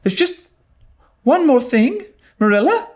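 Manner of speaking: put-on a girl pulling out every argument; pleading young Anne. A narrator's reading. Anne's last try.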